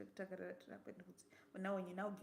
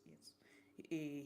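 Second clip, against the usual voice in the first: ordinary talk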